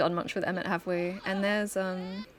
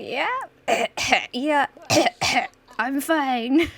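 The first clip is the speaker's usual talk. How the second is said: sickly coughing; 6 semitones higher, 7 dB louder, much slower than usual. Acted. Sick Plenty insists she's fine.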